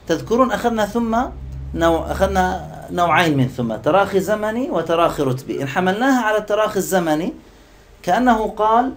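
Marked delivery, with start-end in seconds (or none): none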